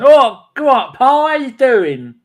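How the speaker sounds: high, loud, slow mock Cockney accent; hammed-up Cockney accent greeting. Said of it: "Did she have a Cockney accent?".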